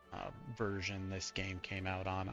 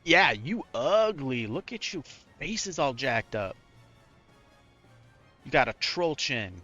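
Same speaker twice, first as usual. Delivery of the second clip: mocking insult; 3 semitones higher, 5 dB louder than usual. Real, not acted. Mocking the villain's face.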